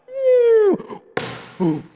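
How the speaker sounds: high, squealed ew; mock disgust. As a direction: squeal ew like a grossed-out teen. God reacts with disgust.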